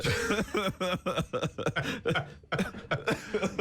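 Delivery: long burst of laughter; hearty laugh. Real, not acted. Radio host amused by his Assimi Goïta quip, laughing hard. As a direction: crack up laughing at your own joke. Host cracks up at own joke.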